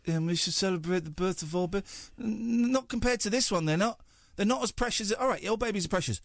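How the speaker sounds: high, overexcited royal superfan; a hammed-up breathless royalist; gushing royalist. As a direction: gush like a devoted fan of the Crown. Gushing royalist persona on radio.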